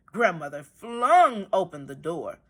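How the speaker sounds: plain reading voice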